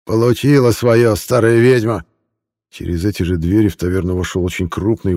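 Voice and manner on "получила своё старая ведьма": gleeful and venomous. A narrator's reading. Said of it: "радостно и ядовито улыбнулся".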